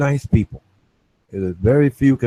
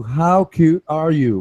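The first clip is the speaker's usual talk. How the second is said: slower and higher, drawn out; mock-sweet teasing, pitched up; teasing, capital letters; 7 semitones higher, slower than usual. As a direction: stretch it out like a taunt. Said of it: "Right, capital letters".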